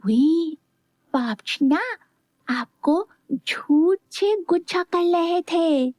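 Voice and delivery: put-on slow, lisping toddler voice; a little boy's slow lisp in her ear; whispering little boy. A narrator's reading. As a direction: tell her a secret like a small child. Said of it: "धीरे से मीरा के कान में बोला".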